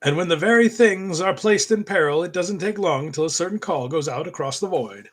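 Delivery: announcer-style comic narration, building a little; dramatic cartoon narration. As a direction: read it like a cartoon intro voice-over. Reads comic narration aloud.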